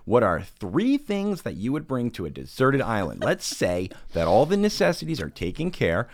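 husband mimicking his wife leading a training class; imitating trainer voice. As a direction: lay out the question like a chirpy class leader. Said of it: "She'll be like".

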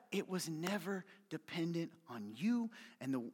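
ordinary delivery

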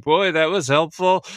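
a dry jab at the unhelpful gesture; sarcastic. Retold or imitated. [name] mocking Cooper's okay sign, sarcastic.

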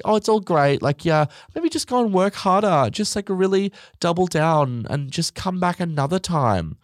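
high-pitched, gushing mock encouragement; falsely encouraging judge. Judge sending a weak singer off, in mock encouragement. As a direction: gush as if it's all lovely, pitched high.

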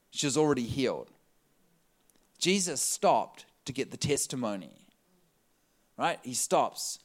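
ordinary talk

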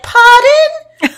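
put-on very high, loud, squeaky little-kid "Pardon?"; mock-cute toddler asking pardon; sweet child's pardon. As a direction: play a tiny polite child asking pardon. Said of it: "they'd be like".

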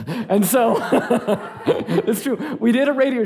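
laughing through the words; light embarrassed laughter. Real, not acted. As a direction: chuckle it out, amused at yourself. Laughing at his radio show.

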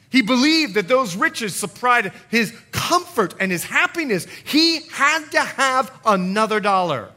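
loud, driving pulpit preaching; full-force preaching. Acted. Pastor urging the church toward godly sorrow, preaching loud. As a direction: preach it hard to the congregation.

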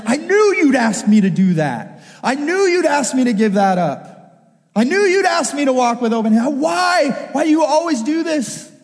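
high, exasperated complaint; hammed-up aggrieved outburst; righteous indignation. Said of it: "I respond with righteous indignation".

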